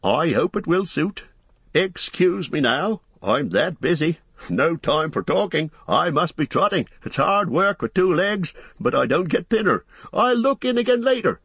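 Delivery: high-pitched fussy innkeeper voice; Barliman Butterbur. Acted. Innkeeper greeting the hobbits, high landlord voice. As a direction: play the chatty landlord, pitched well up.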